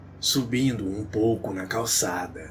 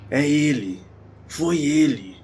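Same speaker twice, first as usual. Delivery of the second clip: raised, higher-pitched cry of alarm; narrated scream; 6 semitones higher, 5 dB louder than usual. A narrator's reading. Wife spots her attacker from the car, mild scream. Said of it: "escutei a Carla gritar".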